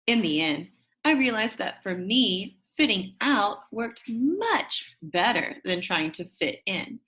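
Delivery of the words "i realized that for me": ordinary delivery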